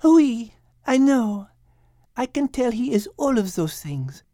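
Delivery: a touch of French charm in every word; French lady. Acted. Liz agreeing with Max in a light French accent. Liz's voice.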